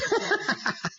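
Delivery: high, uproarious demon laugh. A narrator's reading. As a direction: cackle like a demon. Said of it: "The demon laughed uproariously".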